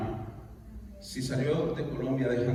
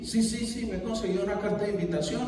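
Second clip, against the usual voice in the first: higher-pitched, rushing list of news; excited; 5 semitones higher, faster than usual. Retold or imitated. Wife telling husband she sold everything, excited. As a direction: imitate an eager, unstoppable announcement.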